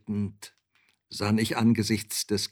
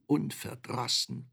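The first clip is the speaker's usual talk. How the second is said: usual speaking voice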